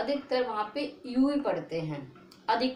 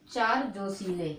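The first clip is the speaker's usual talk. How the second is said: slow mnemonic; 3 semitones lower, slower than usual. Shown as a demonstration. Teacher gives students a memory trick, slowly. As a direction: say चार जोशीले slowly and evenly. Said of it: "क्या लिख पढ़ोगे".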